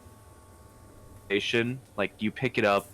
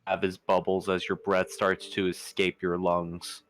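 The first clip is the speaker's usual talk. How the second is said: game master narration. Acted. GM narrates the underwater scene.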